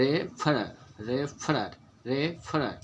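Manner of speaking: Referrer with weight on the middle "फ"; referrer in separated beats, light first, strong middle; stressed middle syllable. Shown as a demonstration. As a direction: lean on the second syllable of referrer. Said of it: "इसके ज़्यादा लगा इस पे".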